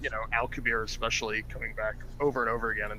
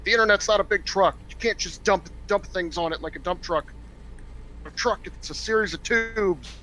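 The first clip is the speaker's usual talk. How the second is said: mocking the senator; 7 semitones higher, 3 dB louder than usual. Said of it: "his speech is all about".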